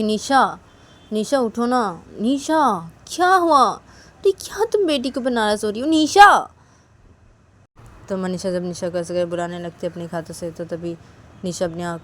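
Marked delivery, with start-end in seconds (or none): 0.0-6.5 louder, higher, slow pleading; coaxing friend. Acted. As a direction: nudge her awake, pleading. Manisha wakes Nisha.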